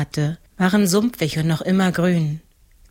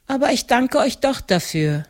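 higher voice of a grateful village mother; lightly relieved, high elderly woman; relieved older woman; 7 semitones higher than usual. A narrator's reading. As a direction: thank him with relief, pitched up. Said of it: "Die Frau atmete erleichtert auf".

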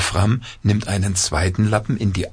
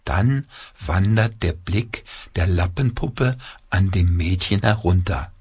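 ordinary delivery

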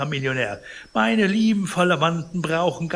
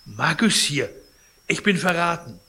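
alarmed cry; 5 semitones higher, 4 dB louder than usual. Acted. Sick man cries betrayal.

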